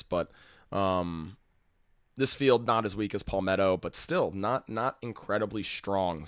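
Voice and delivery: normal talking voice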